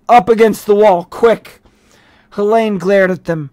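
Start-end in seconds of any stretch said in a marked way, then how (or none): 0.1-1.6 loud barked order; sharp loud police command; policeman's command. A narrator's reading. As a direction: call it out like a cop taking charge. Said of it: "one of them said".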